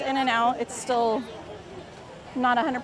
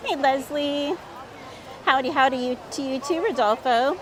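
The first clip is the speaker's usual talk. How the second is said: usual speaking voice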